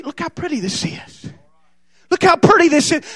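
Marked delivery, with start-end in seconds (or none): 0.0-1.3 tempting hush. Acted. Hell luring the church, hushed and coaxing. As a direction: look how pretty this is said much softer, hushed. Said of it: "that's just how hell will tell you".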